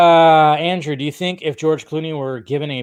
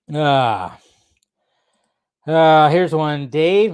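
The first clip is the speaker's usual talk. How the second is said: ordinary talk, nothing marked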